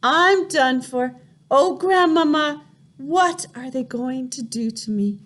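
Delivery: high, frantic inner voice; terrified boy thinking aloud; panicked boy. A narrator's reading. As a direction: panic with nowhere to run. Boy panicking inside the witch room.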